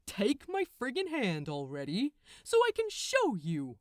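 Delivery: loud, exasperated archangel; impatient Gabriel. A narrator's reading. Gabriel telling Crowley he's dreaming, loud and exasperated.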